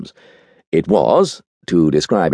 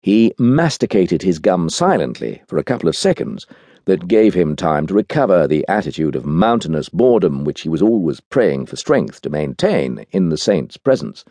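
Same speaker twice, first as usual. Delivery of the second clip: ordinary delivery, nothing marked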